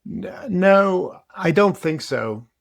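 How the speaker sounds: ordinary talk, nothing marked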